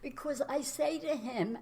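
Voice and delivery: high, trembling-on-tears voice; holding back tears, voice lifted; teary. Real, not acted. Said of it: "I'm starting to sound teary".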